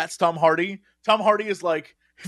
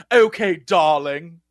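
slow, affected British fop; mock British dandy, languid; foppish British; 3 semitones lower, much slower than usual. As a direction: drawl it like a foppish British actor. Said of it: "sort of a foppish British dude".

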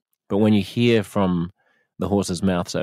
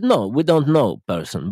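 put-on light Ukrainian accent in broken English, a little higher; Ukrainian bloke's accent; 6 semitones higher, 4 dB louder than usual. Ukrainian man telling the host about friendly strangers, in a light Ukrainian accent. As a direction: imitate the Ukrainian man's amazed, halting English. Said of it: "They said".

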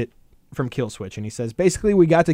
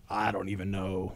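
dazed and lost; 6 semitones lower, 8 dB quieter than usual. Retold or imitated. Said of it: "they just go".

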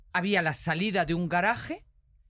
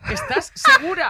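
teasing challenge; 10 dB louder than usual. Real, not acted. Teasing friend at high volume.

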